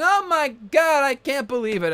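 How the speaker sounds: a commentator losing it at the big spot; commentator freakout. Retold or imitated. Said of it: "He was going".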